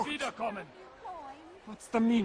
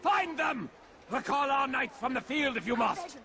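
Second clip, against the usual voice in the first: shout; 7 semitones higher than usual. Acted. Commander demanding more men, shouting. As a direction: roar the order. Commander yells order at guard.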